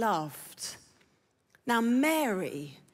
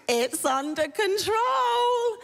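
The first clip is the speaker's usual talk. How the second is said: insistent denial; 7 semitones higher, slower than usual. Acted. Preacher acting someone hiding chaos, forced assurance. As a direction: stretch it out, straining to sound in control.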